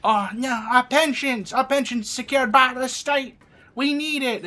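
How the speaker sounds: high, loud wail of mock distress; mock outraged protester. Mimicking protesters clamouring for their pensions, mock outrage.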